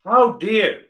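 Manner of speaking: emphatic how dare. Real, not acted. Teacher rebuking listeners' habits, loud and high. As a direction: hit the rebuke hard. Repeated rhetorical scolding.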